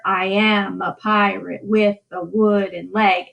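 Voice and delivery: slow, beats stressed in pairs; teacher echoes the video's pirate line in slow meter; iambic reading. Shown as a demonstration. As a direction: tap each stressed syllable like a heartbeat. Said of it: "showing you kind of how we mark".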